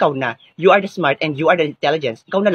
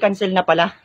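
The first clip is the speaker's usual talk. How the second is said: ordinary talk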